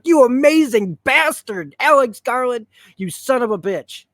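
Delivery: high, loud delighted exclamation; thrilled outburst. Retold or imitated. Reviewer praising the show's creator, excited shout. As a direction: cheer the writer for fooling you. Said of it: "And I was like".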